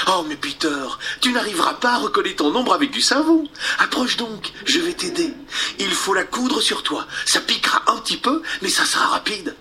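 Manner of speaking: voix de fillette, plus aiguë, rapide; fillette attentionnée. Acted. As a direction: rassurer Peter en cousant. Wendy propose de coudre. (Wendy attentionnée)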